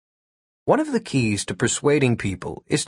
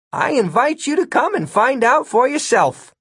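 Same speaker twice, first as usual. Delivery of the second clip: high, friendly, harmless-sounding voice; 12 semitones higher than usual. Acted. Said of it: "his friendliest, most harmless-sounding tone of voice".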